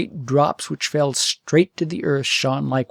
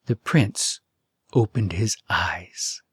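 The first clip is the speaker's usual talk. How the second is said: slow breathy whisper of a reveal; hushed whisper; 6 semitones lower, slower than usual. A narrator's reading. Narrator to listeners, slow hushed whisper. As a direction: breathe it out slowly, barely voiced.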